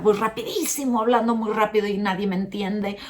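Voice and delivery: a quick rushed demo of talking too fast. Shown as a demonstration. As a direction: rattle it off like you're in a hurry. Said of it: "la velocidad".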